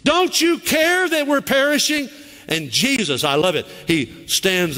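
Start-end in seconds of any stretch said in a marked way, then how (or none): 0.0-2.1 high, slow, anxious calling out; desperate plea. Retold or imitated. Believer crying out to God in a storm, high anxious plea. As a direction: call into the dark, rising in panic. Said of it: "You ever said".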